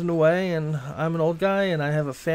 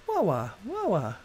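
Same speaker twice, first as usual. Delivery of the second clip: high, soft falling "wah" sounds; playful wah noises; 3 semitones higher, 5 dB quieter than usual. Pretend. Makes playful noises while playing.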